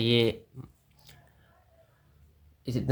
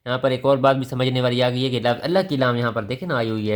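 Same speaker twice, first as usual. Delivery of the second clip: usual speaking voice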